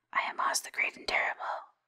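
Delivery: usual speaking voice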